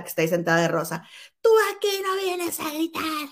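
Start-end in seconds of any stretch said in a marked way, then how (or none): 1.4-3.3 mock yell. Imitating Julia Gama scolding Niurka, high mock yell. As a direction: imitate Julia snapping back, pitched way up. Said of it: "le empieza a gritar así".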